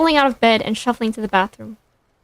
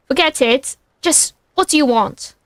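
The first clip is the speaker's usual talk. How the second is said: plain reading voice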